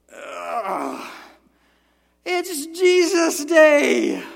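high, loud, very drawn-out reluctant groan; grumpy morning groan. Retold or imitated. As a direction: dread the day ahead, stretched out.

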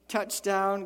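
normal talking voice

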